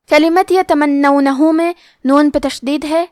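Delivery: usual speaking voice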